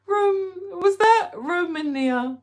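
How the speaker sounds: high, loud startled question; surprised squeak. Real, not acted. Misreading a viewer's name, high surprised squeak. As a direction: catch yourself mid-word, astonished.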